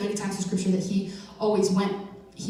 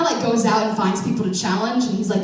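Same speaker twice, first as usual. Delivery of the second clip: ordinary delivery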